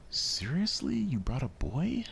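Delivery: high-pitched, scornful outburst; annoyed disbelief. Acted. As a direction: scoff at the boy's arrival. Natsuki annoyed at newcomer.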